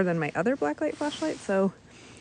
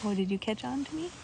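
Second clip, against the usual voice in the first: ordinary delivery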